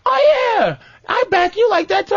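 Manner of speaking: high mock girlfriend, proud. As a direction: voice her high and eager. Girlfriend voiced in story.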